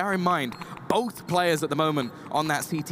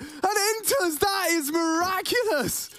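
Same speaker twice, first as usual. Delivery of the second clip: much higher, slow hype call; excited; 14 semitones higher than usual. Real, not acted. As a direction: stretch it out in disbelief. Caster reacting to miracle play.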